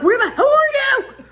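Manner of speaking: high, loud hollered demand; frantic yelling. Acted. Wife calls out to missing husband, frantic high yell. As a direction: yell it up the hill in mock desperation.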